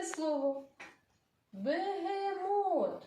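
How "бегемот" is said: slow, sung out Бегемот; drawn-out singsong. Shown as a demonstration. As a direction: stretch Бегемот like a tune. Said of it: "Це довге слово".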